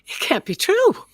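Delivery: high stammering disbelief. Acted. Widow crying out in disbelief at the bigamy. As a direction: break up into a high pitch of denial.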